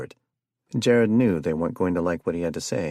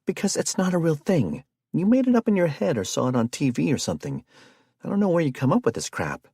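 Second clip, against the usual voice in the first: raised, quick, fed-up retort; higher, faster exasperated yelling; exasperated shout; 10 semitones higher, 3 dB louder, faster than usual. A narrator's reading. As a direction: snap back at him, exasperated. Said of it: "Anna shouted".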